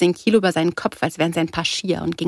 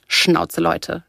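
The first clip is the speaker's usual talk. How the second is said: ordinary delivery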